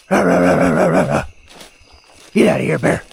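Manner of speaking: loud growling yells; scaring off a bear. Real, not acted. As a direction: roar to drive bears off.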